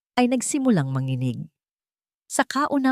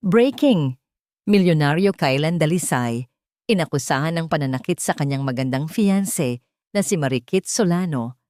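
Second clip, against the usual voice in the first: headline announcement; 2 semitones lower than usual. Acted. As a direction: announce it like breaking gossip. Gossip headline read out. (malakas na pagbasa ng balitang tsismis)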